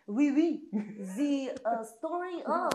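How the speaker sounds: playful fake French accent on request; weird French accent. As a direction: launch into a silly mock French accent. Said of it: "Can you do it in an accent?".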